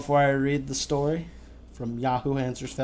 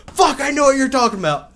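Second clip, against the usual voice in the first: much higher, much louder exclamation; excited outburst; 12 semitones higher, 9 dB louder than usual. Pretend. Friend imagines him blurting recognition, excited shout. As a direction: shout it like the secret just clicked.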